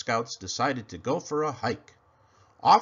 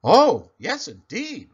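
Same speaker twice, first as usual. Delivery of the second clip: eager reply; 5 semitones higher, 11 dB louder than usual. Acted. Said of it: "replied Pinkerton".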